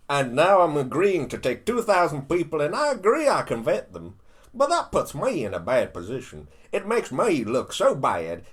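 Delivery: mock-exasperated impression, hitting words hard; Trump. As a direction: do a loud, punchy presidential impersonation. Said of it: "let me do the Trump and the Australian accent".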